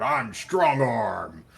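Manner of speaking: loud, drawn-out boastful shout; boastful yell. Acted. Loud hero bragging from the crowd, boastful yell. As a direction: yell it out long like a loud show-off. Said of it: "a crowd of people yelling out".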